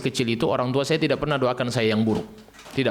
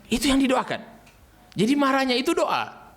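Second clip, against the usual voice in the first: high, animated preacher's point; lively emphatic sermon; excited emphasis; 12 semitones higher than usual. Real, not acted. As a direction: lift it high to drive it home.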